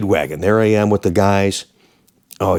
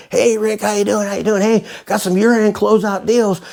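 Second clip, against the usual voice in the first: high, fast, slick salesman pitch; car salesman; 10 semitones higher than usual. Retold or imitated. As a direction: sell it like a pushy car dealer. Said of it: "there's my salesman".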